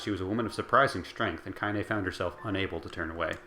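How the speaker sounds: usual speaking voice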